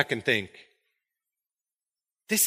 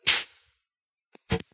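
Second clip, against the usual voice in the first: dismissive breathy scoff; scoffing pfft; 5 dB quieter than usual. Retold or imitated. Judas scoffing at wasted perfume, dismissive pfft. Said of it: "Judas just looks at this and says".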